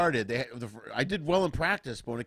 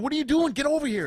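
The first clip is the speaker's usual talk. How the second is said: high, loud teammates calling him over; players yelling; 12 semitones higher, 4 dB louder than usual. Retold or imitated. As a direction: shout him back to the huddle. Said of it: "the players are going".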